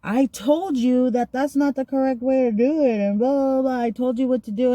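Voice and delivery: high-pitched imitation of scolding; mock yelling. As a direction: mimic an officer telling you off. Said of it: "And she yells at me".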